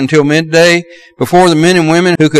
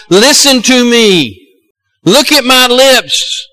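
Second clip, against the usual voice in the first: patient parent; 5 semitones higher, much slower than usual. Retold or imitated. Parent urging a child to listen.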